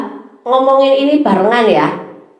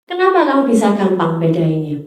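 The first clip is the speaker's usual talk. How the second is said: ordinary talk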